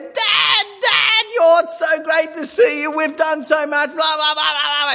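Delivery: put-on a small boy yelling over the top with excitement; excited little boy. Eight-year-old son running out to greet dad, high excited shouting. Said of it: "He runs out".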